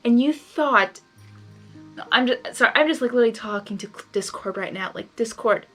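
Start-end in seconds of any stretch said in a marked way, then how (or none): none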